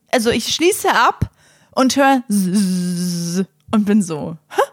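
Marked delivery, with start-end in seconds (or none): 2.3-3.4 slow imitated buzzing zzzz; buzzing wasp noise. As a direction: buzz like a wasp. Hears buzzing in toilet cubicle.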